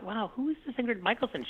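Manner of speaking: high, soft "wow" of discovery; retold wonder. Director recalls first hearing a singer's song, softly amazed.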